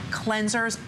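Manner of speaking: normal talking voice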